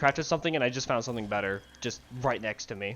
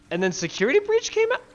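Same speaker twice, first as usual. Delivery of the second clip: much higher disbelieving question; incredulous; 12 semitones higher than usual. Real, not acted. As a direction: sound like you can't believe it.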